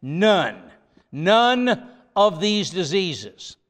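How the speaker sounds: NONE set apart, then repeated and drawn out; weighty, deliberate stress on NONE; emphatic NONE. Retold or imitated. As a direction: land firmly on each NONE, slowly. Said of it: "He was emphatic in saying".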